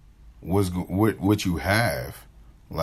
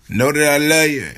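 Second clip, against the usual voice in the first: loud loving sign-off; 8 semitones higher, 9 dB louder than usual. Real, not acted. Warm sign-off to viewers.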